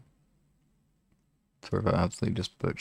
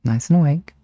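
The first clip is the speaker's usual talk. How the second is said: hypnotist wake-up cue; 7 semitones higher, 8 dB louder than usual. Acted. Hypnotist counting listeners awake.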